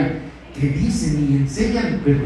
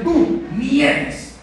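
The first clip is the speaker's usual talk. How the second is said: scornful dismissal; 11 semitones higher than usual. Retold or imitated. Disciples dismissing the foreign woman, mild scorn. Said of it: "Como decían".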